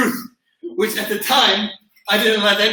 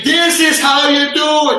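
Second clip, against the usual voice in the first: higher, louder and slow, like a proud demo; showing them how; 7 semitones higher, 6 dB louder, slower than usual. Retold or imitated. Instructor showing students the kick, loud and slow. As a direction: announce the demo big and slow. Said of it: "And I say".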